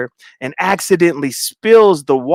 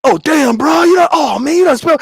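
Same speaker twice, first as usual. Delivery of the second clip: high, louder mock protest; high-pitched retold outburst; acted outcry; 12 semitones higher, 4 dB louder than usual. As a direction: act out a friend exclaiming over a spill. Acts out Lemon getting spilled on.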